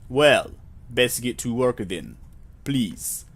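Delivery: slow, put-on Russian accent; faux Russian accent. Clerk sending visitor to work, slow faux Russian accent. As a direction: instruct slowly in a fake Russian accent. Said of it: "a, faux Russian accent".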